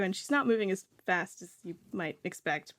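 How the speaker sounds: ordinary delivery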